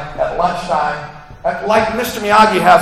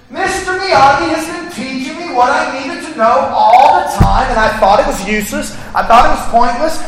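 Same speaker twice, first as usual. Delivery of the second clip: dawning realization; 8 semitones higher, 5 dB louder than usual. Retold or imitated. Daniel's realization, building list.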